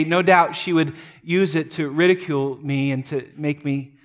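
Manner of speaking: ordinary talk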